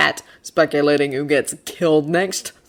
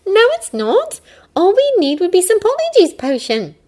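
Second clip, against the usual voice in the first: put-on high girl voice, reasoning; Hermione voice; 5 dB louder than usual. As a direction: lay out the logic.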